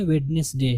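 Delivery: वेडनेसडे with the d voiced, "wed-nes-day"; spelling pronunciation. Shown as a demonstration. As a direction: say वेडनेसडे in three syllables, as written. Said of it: "जो कि गलत है".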